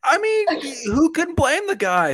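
mock high bro voice. Carries on in mock voice.

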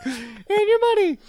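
high, slow squeaky add-on; high mock voice. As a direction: tack it on in a squeaky voice. Adds to mock impression.

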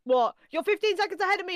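fast, high and loud protest; high-pitched cry of betrayal; outraged yelp. Real, not acted. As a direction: blurt it out fast in disbelief. Live match, stream ahead.

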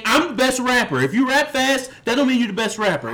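mock boast. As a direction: puff it up as her brag. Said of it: "She's saying".

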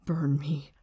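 whispered under the breath, to himself; whispered thought. Retold or imitated. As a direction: hush it like a private thought. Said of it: "he's thinking to himself".

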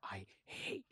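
whispered, slow grumble. Retold or imitated. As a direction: whisper it like a secret complaint. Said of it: "he's like".

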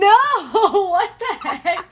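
loud, high, laughing disbelief; laughing protest. Real, not acted. Laughing protest at friend's joke.

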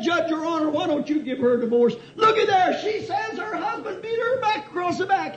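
high, wailing lawyer's appeal; hammed-up emotional plea. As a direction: plead to the judge in mock anguish. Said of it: "go through the same emotion".

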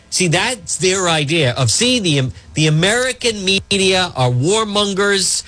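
ordinary talk

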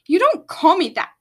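loud, high furious shout; a queen shouting at an insult; angry shout. A narrator's reading. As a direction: put him in his place at full voice. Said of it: "the queen shouted".

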